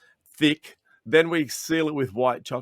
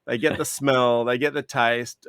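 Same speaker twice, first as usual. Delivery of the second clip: ordinary talk, nothing marked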